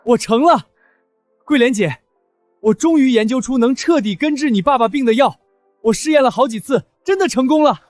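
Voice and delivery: high, elated announcement; excited. Acted. Telling Guilian he found her father's cure, excited. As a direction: announce the breakthrough with rising joy. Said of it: "能让你这么激动".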